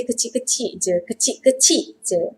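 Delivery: "kecil-kecil" as kechi-kechi, both final l's dropped; informal pronunciation. Shown as a demonstration. Teacher shows how Malays speak, informal "kecil-kecil" without l's. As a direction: drop each l in "kecil-kecil je". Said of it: "But when we speak".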